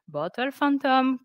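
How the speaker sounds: usual speaking voice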